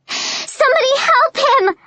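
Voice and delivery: high, desperate scream for help. Acted. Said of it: "I scream".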